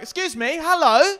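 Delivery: high, loud barked hello; commanding mum shout. Retold or imitated. Mimicking the mum scolding the teen, high loud command. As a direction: bark it like an order. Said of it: "I just wanted to be like".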